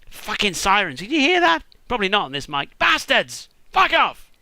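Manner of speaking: loud, high curse at sirens; real annoyed yell. Real, not acted. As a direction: shout at the noise outside. Real sirens interrupt recording.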